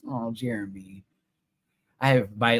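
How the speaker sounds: ordinary delivery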